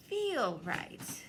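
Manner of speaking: plain reading voice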